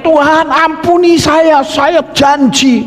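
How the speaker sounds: high, stretched begging cry; mock desperate yell. Sinner begs God for another chance, high desperate cry. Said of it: "Kita mau teriak".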